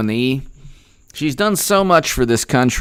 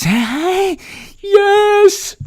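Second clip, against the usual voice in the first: very high squealed cheer; drawn-out falsetto victory cry; mock victory yell. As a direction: squeal it out in pretend triumph.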